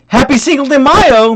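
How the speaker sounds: exaggerated party shout; mock festive greeting. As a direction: belt out a cheeky celebration. His retold cheeky reply.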